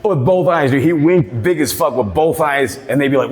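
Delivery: normal talking voice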